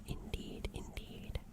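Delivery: ordinary delivery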